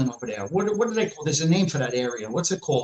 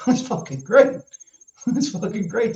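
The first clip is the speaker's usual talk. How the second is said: laughing; 7 semitones higher than usual. Real, not acted. Laughing at his own joke.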